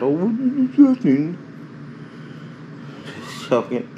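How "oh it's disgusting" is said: high, slow, prissy mocking voice; mock disgusted viewer. Mocking viewers' fattening joke in a high squeal. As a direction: parody a squeamish critic, drawn out. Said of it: "make some kind of a fattening joke".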